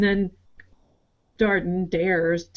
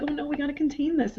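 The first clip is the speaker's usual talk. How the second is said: mock freaking out; 8 semitones higher, 4 dB quieter, faster than usual. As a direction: imitate people fretting in a higher voice. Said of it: "everybody's freaking out".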